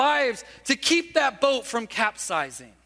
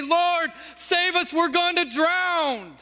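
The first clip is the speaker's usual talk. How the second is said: crying out in unison; 7 semitones higher, slower than usual. Retold or imitated. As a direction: call out to him for rescue. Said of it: "cry out to Jesus".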